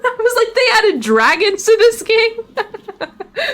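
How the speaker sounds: high-pitched, cracking up laughing; laughing disbelief. Real, not acted. Streamer stunned by dragons in the game, laughing disbelief. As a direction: squeal it through real laughter, drawn out.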